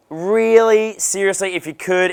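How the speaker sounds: a touch of mock disbelief and pleading; acted pleading. Acted third plea for a favour.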